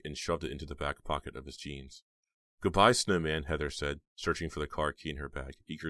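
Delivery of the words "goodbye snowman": loud goodbye. A narrator's reading. Heather escaping.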